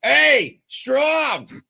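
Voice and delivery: high, loud, slow fan yelling from the stands; heckling Boston fan. Pretend. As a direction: jeer at the pitcher across the park. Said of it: "So here's what it might sound like, uh, mid-season for you".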